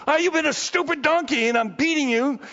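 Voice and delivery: high-pitched acted scolding; annoyed Balaam. Balaam scolds the donkey he is beating, annoyed. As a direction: scold the animal in exasperation. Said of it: "He's just like".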